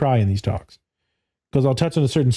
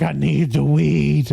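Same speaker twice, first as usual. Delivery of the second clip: imitating clients; 2 semitones higher, slower than usual. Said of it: "And they're like".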